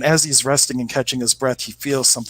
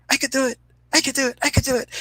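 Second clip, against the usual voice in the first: much higher, squeaky little bird voice, eager; tiny hummingbird voice; 14 semitones higher, faster than usual. Retold or imitated. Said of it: "then all of a sudden you hear".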